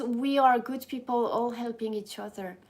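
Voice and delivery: ordinary talk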